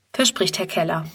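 ordinary delivery

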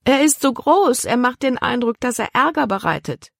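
put-on high-pitched girl's objection; protesting. A narrator's reading. Libby complains to father about dog, protesting.

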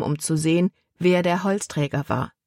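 usual speaking voice

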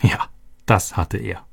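ordinary delivery

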